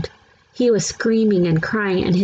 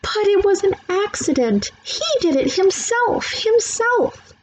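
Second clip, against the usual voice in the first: crying; 14 semitones higher than usual. A narrator's reading. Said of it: "Sheila cried louder".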